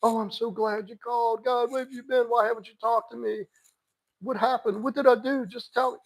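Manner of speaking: high, pleading, clingy whine; mock desperate ex. Mocking what not to say when he calls, high and pleading. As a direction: play the hurt girlfriend begging for answers. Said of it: "don't go".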